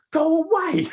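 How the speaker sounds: high, loud mocking dismissal; mock group brush-off. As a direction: shoo her off in a squeaky yell. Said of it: "They're all like".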